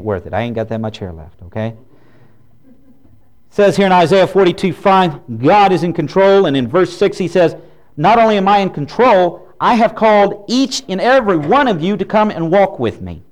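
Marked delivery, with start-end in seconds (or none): 3.5-13.2 raised, louder preaching voice. Acted. Preacher to congregation, raised and louder. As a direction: preach it out with full force to the pews.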